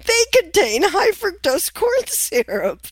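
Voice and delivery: high-pitched mock objection through laughter; giggly, squeaky mimicry of an indignant correction; barely without laughing. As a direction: quote them while cracking up. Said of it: "I can't even say it without laughing".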